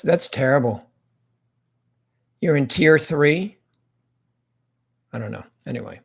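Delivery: usual speaking voice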